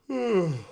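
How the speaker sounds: a long stretching yawn. Shown as a demonstration. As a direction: yawn with the throat wide open. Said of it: "Feel it again".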